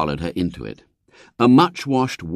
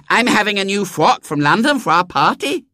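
put-on high, bossy little girl; a spoilt small girl giving orders; 11 semitones higher, 3 dB louder than usual. A narrator's reading. Violet Elizabeth voiced.

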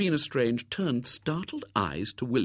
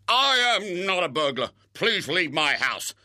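angry bellow; 7 semitones higher, 4 dB louder than usual. Acted. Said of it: "with a bellow of rage".

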